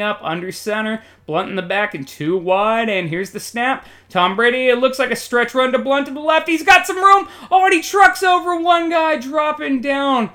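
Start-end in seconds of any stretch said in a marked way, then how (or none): 4.1-10.3 building to a high, loud shout, easing at the end; urgent, high-pitched sportscaster call; excited play-by-play. Pretend. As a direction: shout as the runner breaks loose. Live call of a big run.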